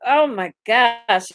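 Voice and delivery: ordinary delivery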